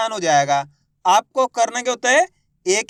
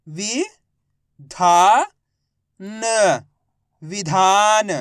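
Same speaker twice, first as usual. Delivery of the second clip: direct syllable reading; 4 dB louder, much slower than usual. Shown as a demonstration. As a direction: sound out विधान piece by piece. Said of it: "direct मात्रा का उच्चारण करके पढ़ेंगे". (वि, धा, न अलग-अलग, फिर विधान खींचकर)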